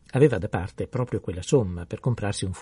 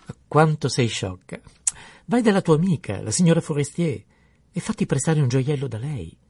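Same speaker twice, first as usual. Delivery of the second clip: loud and higher, exasperated exclamation; exclaiming; 5 semitones higher, 5 dB louder than usual. A narrator's reading. Husband scolding wife and suggesting her friend, loud and higher. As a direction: burst out loud at a silly wife. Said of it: "Il marito esclamò".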